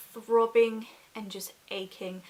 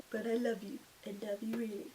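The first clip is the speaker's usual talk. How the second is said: tender; 6 dB quieter, faster than usual. Real, not acted. Talking tenderly to her baby.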